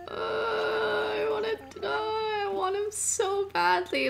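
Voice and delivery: high, slow, half-crying squeal; overwhelmed squeal. Real, not acted. Squealing, half-crying, at the sight of her dream villager. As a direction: wail like a fan who just saw her idol.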